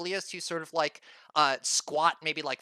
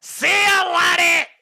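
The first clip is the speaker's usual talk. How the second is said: much higher, loud shouted farewell; drunk farewell shout; 11 semitones higher, 9 dB louder, slower than usual. Acted. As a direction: holler it after him. Drunk barkeep calling goodbye.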